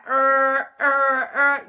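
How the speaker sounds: mimicked alarm going off; alarm siren. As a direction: honk out three siren bursts. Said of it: "the alarm sirens are going off".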